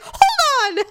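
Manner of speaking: high-pitched call to stop; mock husband's yell. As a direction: imitate a flustered husband calling after someone. Retold husband calling after her.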